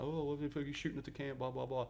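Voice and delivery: high, fast panicky imitation; mock flipping out. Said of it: "first sergeant's in there flipping out".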